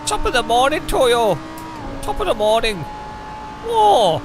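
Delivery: over-the-top cheery Irish greeting; Irish accent. Acted. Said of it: "I can't do Irish".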